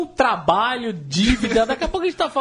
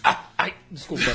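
choking; 4 semitones lower, 3 dB quieter than usual. Real, not acted. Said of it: "Eu engasguei aqui um pouco".

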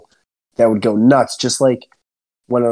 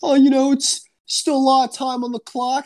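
much higher put-on dad voice; mocking dad's reassurance; 12 semitones higher than usual. Son mimicking dad when the team is losing, mock reassurance. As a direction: parody a parent's needling consolation in a high voice. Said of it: "Don't give me that whole".

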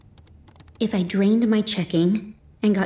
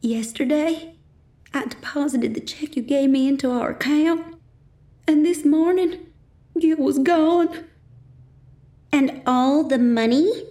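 higher, tearful mother's voice; higher and weepy, close to tears; 9 semitones higher than usual. A narrator's reading. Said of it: "my mom's tearful voice".

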